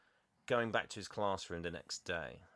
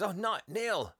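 gushing compliments, starting high-pitched; too nice; 5 semitones higher than usual. Retold or imitated. Headmaster greeting Neil, overly nice gushing. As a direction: overdo the niceness, acted out. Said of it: "headmaster's a little too nice".

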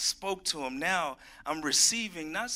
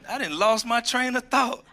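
higher and louder, mock annoyed at the interruption; mock exasperation; 7 semitones higher, 6 dB louder than usual. Preacher imitating himself snapping at Martha, mock exasperated. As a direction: play it fed up and flustered. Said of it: "I'd be like".

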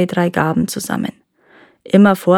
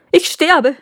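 high-pitched dying complaint; hammed-up, higher cry; melodramatic wail; 8 semitones higher than usual. A narrator's reading. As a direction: insist you are dying. Husband repeats his dying threat.

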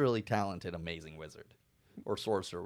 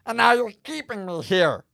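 angry accusation; 14 semitones higher, 8 dB louder than usual. Acted. Character accuses snake.

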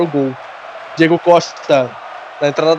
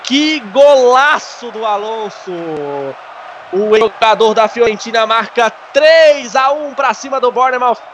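excited goal commentary; 6 semitones higher than usual. Real, not acted. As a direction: ride the goal high. Post-goal excited recap.

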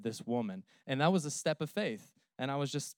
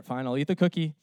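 normal talking voice

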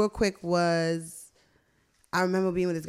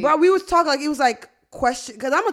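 excited; 7 semitones higher, 7 dB louder than usual. Real, not acted. Answering the co-host defensively.

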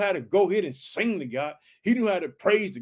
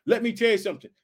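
normal talking voice

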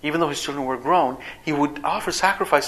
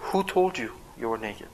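lower, soft, calm asking; respectful, gentle question; 4 semitones lower, 9 dB quieter than usual. Shown as a demonstration. God gently questions Adam, soft and respectful. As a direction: ask gently, with respect, no blame. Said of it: "Notice the respectful tone".

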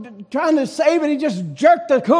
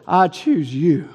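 God tenderly choosing the sinner; 7 semitones lower, slower than usual. Acted. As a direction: say it gently and slowly, like a promise.

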